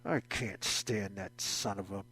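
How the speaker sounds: low, muttered grumble; under his breath. Retold or imitated. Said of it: "under his breath".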